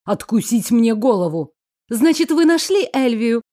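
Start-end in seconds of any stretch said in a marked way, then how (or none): 1.9-3.4 bright, higher happy exclaim; joyful exclaim. A narrator's reading. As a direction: glad cry of news. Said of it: "радостно воскликнула я".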